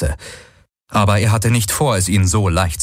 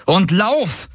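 urgent, high-pitched command; rufen; 10 semitones higher than usual. A narrator's reading. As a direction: call out a high order to flee. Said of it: "rief Galen". (hoher, drängender Ruf)

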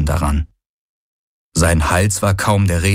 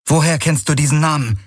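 sharp voice; 7 semitones higher than usual. A narrator's reading. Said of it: "fragte sie mit scharfer Stimme".

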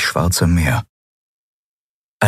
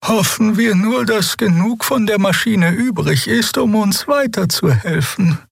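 much higher, otherworldly hopeful voice; ethereal creature. A narrator's reading. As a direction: float it like a strange being. Voices Xea. (hohe, fremdartige Wesensstimme)

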